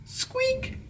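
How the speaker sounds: tiny very high squeak; a very high, soft mouse squeak. A narrator's reading. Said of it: "said the mouse".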